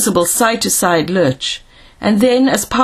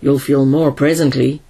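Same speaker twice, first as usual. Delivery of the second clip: low, grim reply; 6 semitones lower than usual. A narrator's reading. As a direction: answer grimly, dropping the pitch. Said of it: "was the grim reply".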